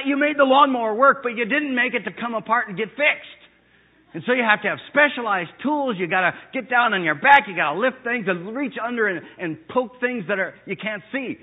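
loud, high preacher's climax; thundering pulpit voice; full-force preaching. Acted. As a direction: preach it out to the back row. Ranting preach on engineers.

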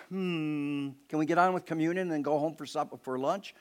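mumbled, hurried bored listener voice; bored churchgoer. Pretend. Preacher mocking a bored listener who wants lunch, in a mumbling hurried voice. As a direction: sound like someone itching to leave. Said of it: "It's not just to be read and go".

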